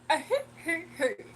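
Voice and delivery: mock cartoon laugh; a quick imitated cartoon chuckle; Cleveland laugh. Streamer showing how Cleveland laughs, a light imitation. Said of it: "That's how he laugh".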